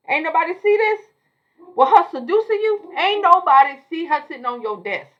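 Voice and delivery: loud and high disbelief; incredulous. Real, not acted. Incredulous rant about the scene.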